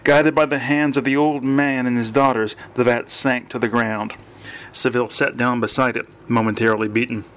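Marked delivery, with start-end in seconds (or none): none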